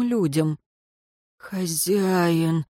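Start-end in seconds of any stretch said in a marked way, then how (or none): 1.4-2.7 drawn-out groaning plea; moaning undead. A narrator's reading. Undead servant calls his master, moaning. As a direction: moan it out slowly. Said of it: "простонал нелюдь".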